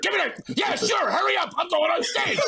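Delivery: bossy pro shooing him off; impatient Sherry. Retold or imitated. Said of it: "she's like".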